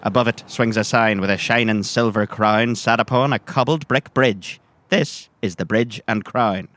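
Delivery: ordinary talk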